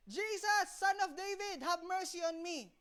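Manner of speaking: mock persistent beggar's call, slowing at the end; imitated insistent cry. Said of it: "Gusto pa rin".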